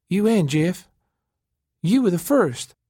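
high, thin accusation. A narrator's reading. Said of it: "she said in a thin voice".

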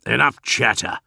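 sharp, loud sergeant's snarl. A narrator's reading. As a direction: cut them off with a snarl.

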